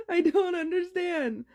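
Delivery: high, loud laughing through the words. Real, not acted. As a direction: crack up while saying it. Laughing at her own dream.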